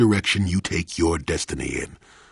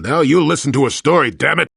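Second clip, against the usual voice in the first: louder, higher, fast and fed up; gruff outburst; 7 semitones higher, 6 dB louder, faster than usual. Acted. Old man scolding his nosy companion, irritated outburst. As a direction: snap at him to finally listen.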